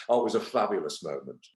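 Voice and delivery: ordinary talk, nothing marked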